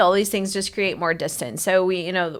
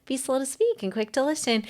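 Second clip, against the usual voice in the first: higher-pitched mock-sweet counselor voice; patronizing; 5 semitones higher, 4 dB quieter than usual. Counselor lecturing someone who is fighting themselves, patronizing. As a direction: imitate a condescending advice-giver, pitched up. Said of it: "patronizing you going".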